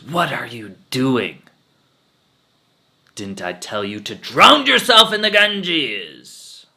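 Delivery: loud, slow, rising indignation; slow, loud scolding; outraged guru. Acted. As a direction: berate her like an offended master. Guru berates returning old lady.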